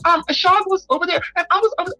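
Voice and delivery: high-pitched, hesitant mock-little-girl voice; irregular childlike voice. Mocking a woman who stalls and rambles, high childlike voice. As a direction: dither in a put-on childish squeak. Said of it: "Nobody's talking like that".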